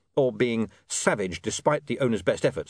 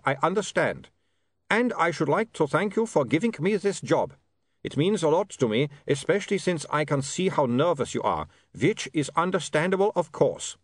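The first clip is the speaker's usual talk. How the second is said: put-on higher-pitched, accented vampire voice; polite, reassuring vampire with a foreign accent; courteous vampire Otto; 6 semitones higher than usual. Otto thanks William for the job, courteous vampire voice.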